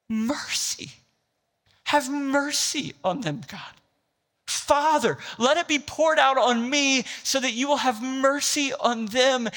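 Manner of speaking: fervent pleading sermon cry; anguished plea. Retold or imitated. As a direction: cry it out as a desperate prayer. Voicing Jesus crying from cross.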